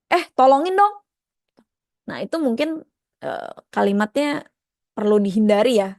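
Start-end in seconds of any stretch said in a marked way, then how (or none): 0.1-0.9 high, louder pushy "tolongin dong"; casual demand. Shown as a demonstration. Teacher demonstrating a bossy request to a friend. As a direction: toss off an offhand order. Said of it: "Itu lebih baik dibilang".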